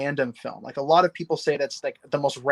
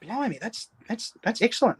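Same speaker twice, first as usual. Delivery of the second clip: higher, hesitant put-on British voice; mock British accent; 7 semitones higher, 4 dB quieter than usual. Guest admires co-host's accent, in a mock British accent. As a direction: stumble through praise in British. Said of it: "I especially love doing British accents".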